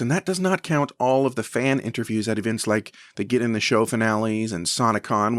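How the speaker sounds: usual speaking voice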